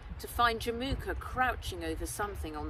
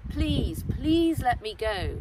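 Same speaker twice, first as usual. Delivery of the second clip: higher, louder, slow begging; pleading; 5 semitones higher, 5 dB louder, slower than usual. A narrator's reading. Bound Nat begging her captor, higher pleading voice. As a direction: beg to be set free. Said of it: "she tried again".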